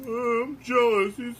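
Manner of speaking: high whiny voice of a jealous action figure; jealous toy voice. Acted. Toy figure Jamie complaining to his owner, high jealous whine. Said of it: "He's like".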